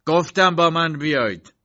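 loud, high, drawn-out order; shouting. A narrator's reading. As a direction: shout it as a command. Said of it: "داد زد".

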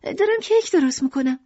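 high, bright-hearted mother's voice; Lucrèce playing the chipper wife; cheerful mother. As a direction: reassure him, pitched high.

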